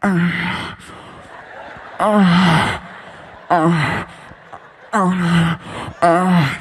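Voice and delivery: rhythmic contented grunts while chewing; eating grunts. Retold or imitated. Comedian chewing a burger, contented grunts. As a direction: grunt with each bite. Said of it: "I make sounds when I eat".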